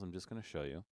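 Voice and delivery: ordinary delivery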